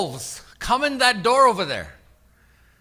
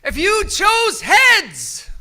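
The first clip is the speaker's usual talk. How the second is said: calling out; 8 semitones higher, slower than usual. Real, not acted. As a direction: call it out big and slow. Host calling out to crowd.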